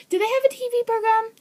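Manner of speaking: high, fast incredulous question; surprised question. Real, not acted. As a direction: sound genuinely startled they have a show. Surprised question about TV show.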